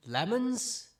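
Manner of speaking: loud, high incredulous question; high, rising astonished echo; baffled dwarf. Acted. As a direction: blurt it back in disbelief.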